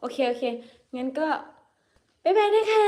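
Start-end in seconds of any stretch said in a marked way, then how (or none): 2.2-2.8 much higher, loud, sing-song bye-bye; cheerful goodbye. Real, not acted. Streamer waving off live viewers, in a much higher, loud chirpy goodbye.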